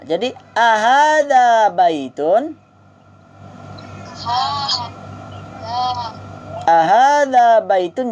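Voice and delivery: slow, drawn-out Arabic drill phrase; slow model for repeating. Shown as a demonstration. Teacher models the phrase slowly for students to repeat. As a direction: spell out each syllable for the class to echo. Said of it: "drill model for repeating".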